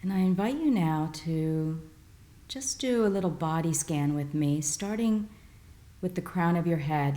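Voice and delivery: calm meditation voice with long pauses; guided meditation. Real, not acted. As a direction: guide listeners gently through breath and body, unhurried. Leads breathing and body scan.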